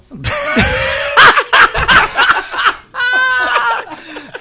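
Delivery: loud, high burst of laughing; explosive laughter. Real, not acted. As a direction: crack up uncontrollably at a joke. Reacting to retired Aztec joke.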